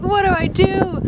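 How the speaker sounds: high, helpless mock wail; retold panic. Traveler stuck outside the pay bathrooms, panicking.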